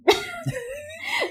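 real amused laugh; high burst of laughter. Real, not acted. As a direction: laugh at your own teasing. Laughs at own remark.

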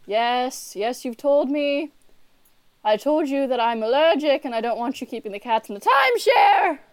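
exasperated, building to a shout; loud, fed-up complaint; Merlin. Acted. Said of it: "And he's like".

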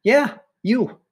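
high-pitched, teasing insistence; emphatic confirmation. Acted. Coach reassures disbelieving pupil.

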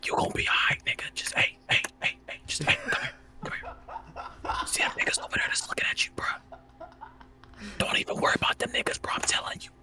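urgent hushed reassurance, all whispered; conspiratorial whisper. Acted. Positivity dealer secretly cheering up a friend, conspiratorial whisper. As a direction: whisper like a dealer calling someone aside.

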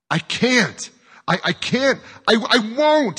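high, loud, stammering outburst; screamed refusal. A narrator's reading. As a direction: scream it in desperation. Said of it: "he screamed".

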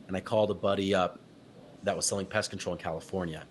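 ordinary talk, nothing marked

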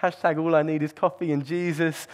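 usual speaking voice

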